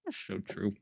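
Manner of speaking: talking around a Twinkie; words through a mouthful of cake; mouth full. Real, not acted. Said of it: "I'm eating a Twinkie".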